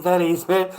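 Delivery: ordinary talk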